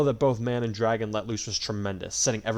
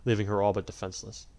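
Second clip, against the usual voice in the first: ordinary delivery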